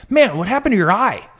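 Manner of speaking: high blunt kid impression. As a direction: blurt it like a nosy boy. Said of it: "the kid is just like".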